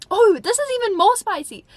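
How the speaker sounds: high, loud gleeful exclamation; excited squeal. Real, not acted. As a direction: shriek over even juicier gossip. Excited reaction to juicier diary page.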